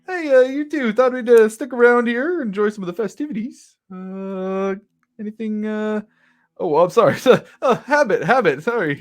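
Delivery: friendly shopkeeper voice. Acted. Shop owner chatting with Milo about the festivities, high and bubbly. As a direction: ramble cheerfully, then catch yourself.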